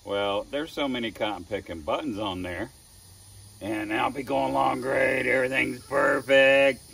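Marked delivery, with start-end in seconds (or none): none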